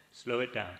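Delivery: ordinary talk, nothing marked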